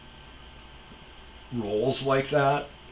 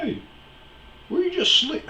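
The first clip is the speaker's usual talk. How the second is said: much higher, louder mock yell of a stranger; imitating the dude; 5 dB louder than usual. Stranger yelling at him over the weed eater, imitated high and loud. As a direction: squawk it like the guy who came up yelling. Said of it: "He's like".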